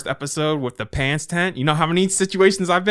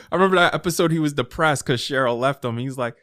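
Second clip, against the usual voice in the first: usual speaking voice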